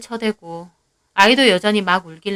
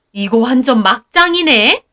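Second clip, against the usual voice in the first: scornful mother-in-law; 2 semitones higher, 6 dB louder than usual. Acted.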